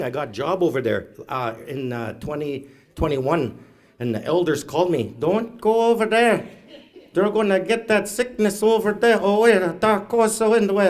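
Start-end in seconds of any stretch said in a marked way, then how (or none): 5.2-10.9 high-pitched imitation of a worried elder; elders' urgent warning. Elder phones to warn against travelling, high urgent voice. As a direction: raise the voice high, warning someone off.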